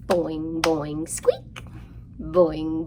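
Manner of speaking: ordinary talk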